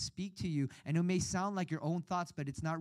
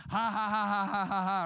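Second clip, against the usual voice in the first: fake laugh; 2 semitones higher than usual. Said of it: "pretend to laugh".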